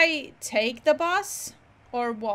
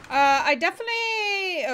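startled yelp; 8 semitones higher than usual. Real, not acted. Car crash mishap in game.

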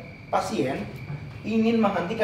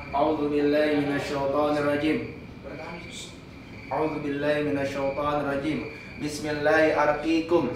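ruqyah prayer. Real, not acted. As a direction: recite the opening prayer in a level monotone. Recites opening ruqyah prayers. (bacaan doa ruqyah datar)

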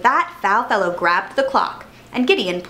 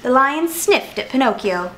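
ordinary delivery, nothing marked

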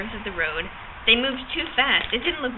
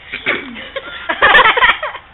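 another loud burst of laughter; can't stop laughing, let it out; 12 semitones higher, 8 dB louder than usual. Real, not acted. Reader laughing loudly again between lines. Laughter continues mid-reading.

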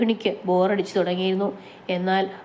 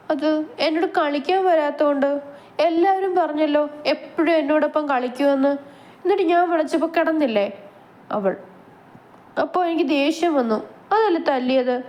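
put-on childish sulk; 7 semitones higher than usual. Man-child sulking explanation.

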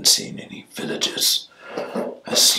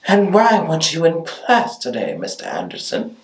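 wicked teacher; 10 semitones higher than usual. Shown as a demonstration. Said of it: "Like the wicked teacher".

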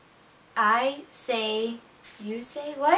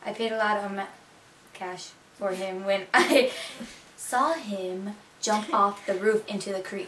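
Miami-accented, long vowels in "roof" and "creek"; 3 semitones lower, 4 dB quieter than usual. Shown as a demonstration. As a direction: translate it carefully into a Miami accent. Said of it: "Now for some sentences for you guys".

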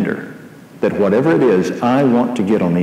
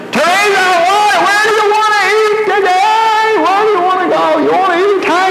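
much higher shouted call over the noise; yelling over blow dryer; 4 dB louder than usual. Retold or imitated. Husband calling to his wife over her blow dryer, much higher yell. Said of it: "yelling at her".

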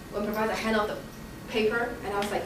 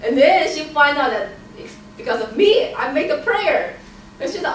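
ordinary delivery

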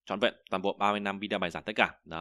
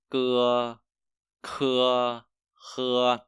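loud, slow drill of Chinese initials with long pauses between syllables; loud, spaced-out pronunciation drill; louder drill read-out; 3 semitones higher, 5 dB louder, much slower than usual. Shown as a demonstration. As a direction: call each syllable out loud for the class to repeat. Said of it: "Lại nha".

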